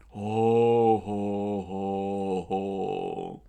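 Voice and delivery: mocking ho ho laugh. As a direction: chuckle along scornfully. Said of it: "Four joined in".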